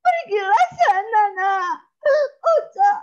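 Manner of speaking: loud, high sobbing farewell; crying mother. Acted. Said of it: "perempuan yang menangis".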